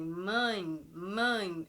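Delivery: mãe said twice, nasal vowel held. Shown as a demonstration. Teacher repeating mãe to show the nasal sound. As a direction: let mãe ring through the nose. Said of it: "o som sai mais nasalizado".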